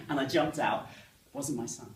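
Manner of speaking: normal talking voice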